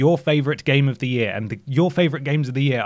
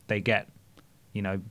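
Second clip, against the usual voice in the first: ordinary delivery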